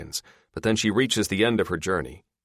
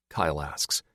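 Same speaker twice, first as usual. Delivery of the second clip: ordinary delivery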